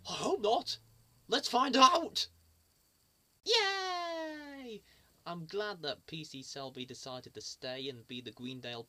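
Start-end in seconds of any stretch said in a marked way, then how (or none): 0.1-0.8 mock gasp of worry. News a policeman may leave. 1.3-4.8 excited cheer. Real, not acted. Cheering to start the programme.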